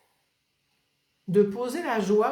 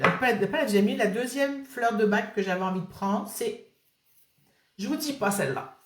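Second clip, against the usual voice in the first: normal talking voice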